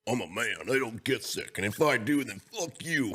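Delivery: hammed-up macho boast; put-on tough-guy imitation, pitch climbing; bad mocking impression. Mimicking co-host who claims he never gets sick, mocking impression. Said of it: "That was the worst impression".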